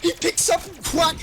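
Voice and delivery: very high excited shout. Real, not acted. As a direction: scream it out as the streak lands. Caster calling a spy streak.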